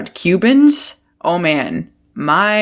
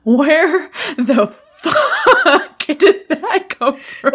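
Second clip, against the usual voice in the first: laughing quote; 9 semitones higher, much slower than usual. Retold or imitated. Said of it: "and he's like".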